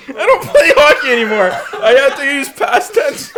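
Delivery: laughing at himself, loud; cracking up mid-sentence. Real, not acted. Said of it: "I'm so stupid".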